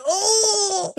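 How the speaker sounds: high drawn-out squealed "oh"; comic wail of a tiny creature; imitated leech scream. Leech being burnt by a cigar, screaming. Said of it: "sound effect of the leech screaming".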